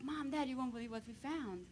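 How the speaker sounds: ordinary talk, nothing marked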